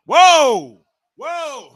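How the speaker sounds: sudden high whoa of shock; surprised shout. Real, not acted. Streamer reacting live to a dive off the railing, shocked. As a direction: gasp out loud at the dive.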